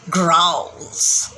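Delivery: low growled word; low tiger growl. Shown as a demonstration.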